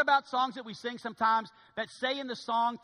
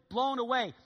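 normal talking voice

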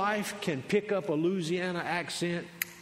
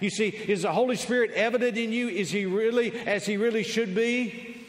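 high, urgent pulpit questioning; preacher pressing a question home; full-force preaching; 6 semitones higher, 4 dB louder than usual. Real, not acted. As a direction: challenge the congregation with raised voice.

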